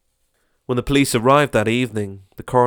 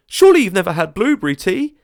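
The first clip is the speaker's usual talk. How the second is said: higher, loud disbelieving voice; incredulous neighbour; 10 semitones higher, 9 dB louder than usual. Acted. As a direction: gasp at him in mock disbelief. Crowd offering teas.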